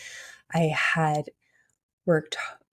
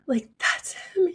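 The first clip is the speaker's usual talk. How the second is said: choked-up whisper; 12 semitones higher, slower than usual. Retold or imitated. Overwhelmed by vision of husband.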